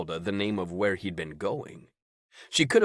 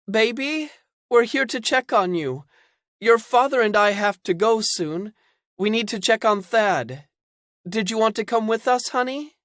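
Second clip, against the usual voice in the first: high, loud, syrupy parent voice; sugary mother; 14 semitones higher, 6 dB louder than usual. Acted. Mother at the door pretending to care, high and sweet. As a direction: coax him like a fake-sweet mother.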